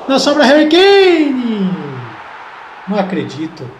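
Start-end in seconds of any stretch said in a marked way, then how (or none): 0.1-1.7 excited commentary. Real, not acted. Goal chance in football commentary.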